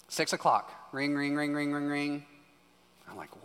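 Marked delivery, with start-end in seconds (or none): 0.9-2.2 mock phone ring, lightly sung out; imitating a phone ring. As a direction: play the phone ringing in the morning. Phone ringing at six o'clock.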